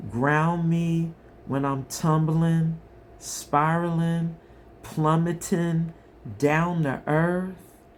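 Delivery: slow, swelling recital of the lyrics; dramatic lyric reading. Acted. Tarot reader reciting song lyrics to her viewers, slow and emotive. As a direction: read the lyrics like a heartfelt poem.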